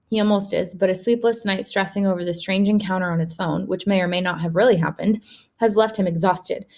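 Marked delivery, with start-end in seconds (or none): none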